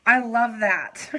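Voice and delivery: drawn-out heartfelt I love that. Real, not acted. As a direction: lean into it, stretched. Repeats touched line.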